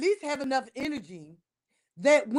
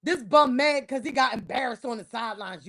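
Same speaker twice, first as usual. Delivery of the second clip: usual speaking voice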